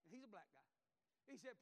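normal talking voice

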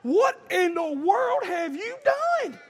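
high, slow, drawn-out disbelief; retold shocked dad. Father reacting to his screaming son, drawn-out mock shock. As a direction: stretch it out as an aghast parent. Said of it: "And I was like".

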